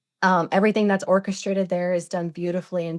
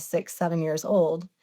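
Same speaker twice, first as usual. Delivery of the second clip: normal talking voice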